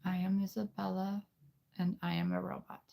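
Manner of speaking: put-on high, soft girl-robot voice; squeaky little-girl robot persona. Shown as a demonstration. For viewers, trying out the girl voice, high and soft. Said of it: "We'll, we'll do the girl voice here".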